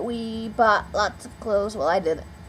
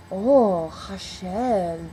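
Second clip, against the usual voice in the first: impressed reaction; 4 dB louder, much slower than usual. Retold or imitated. Acts his delighted reply to name.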